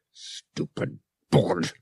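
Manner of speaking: low, slow snarl under the breath. A narrator's reading. Han cursing the stubborn board, snarling. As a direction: spit it out at the broken console. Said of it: "Han snarled".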